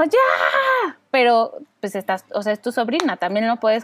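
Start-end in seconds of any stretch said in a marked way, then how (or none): 0.0-1.0 high shouted ya; shouted stop. Retold or imitated. Mom shouting to break up kids' fight. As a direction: snap it out to stop kids. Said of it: "es así como".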